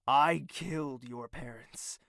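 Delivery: usual speaking voice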